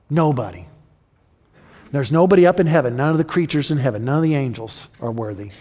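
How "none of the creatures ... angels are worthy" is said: usual speaking voice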